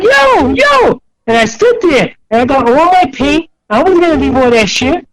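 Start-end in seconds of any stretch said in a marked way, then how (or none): 0.0-1.0 laughing call. Retold or imitated. Laughing calling after friends.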